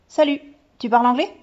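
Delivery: put-on high, loud, quick child's voice; young boy. A narrator's reading. Boy greets new girl in the hall, chirpy. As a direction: call out a quick kid's hello.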